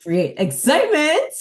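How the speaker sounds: high, loud burst of excitement; excited. Shown as a demonstration. Trainer showing how excitement sounds. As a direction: pump the words up high and loud. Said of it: "You can go up".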